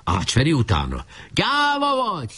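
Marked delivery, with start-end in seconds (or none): 1.3-2.4 high, drawn-out jeer; shouting. A narrator's reading. Pursuer taunts the fleeing leader, high shout. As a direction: jeer slowly in a high cry. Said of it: "kiáltott rá".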